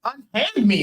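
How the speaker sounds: high, hammed-up unhand me; mock-gallant refusal; mock formal. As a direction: play it like an old-time hero. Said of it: "And I'm like".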